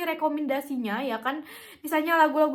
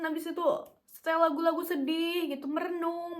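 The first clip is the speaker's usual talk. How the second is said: ordinary delivery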